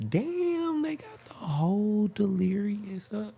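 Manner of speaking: high, delighted exclamation; excited surprise. Real, not acted. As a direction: voice jumps up with delight. Finds Eddie Murphy special online.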